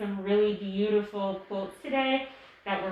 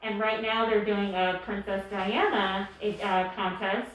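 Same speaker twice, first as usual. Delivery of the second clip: ordinary delivery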